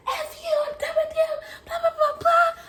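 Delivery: very high-pitched mock rant; squealed, acted fury; mad retold yelling. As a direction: screech it up at him, hammed up. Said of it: "I'm mad".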